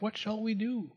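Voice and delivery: soft, high, worried aside; hushed question to a wife; whispered. A narrator's reading. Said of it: "he whispered to his wife".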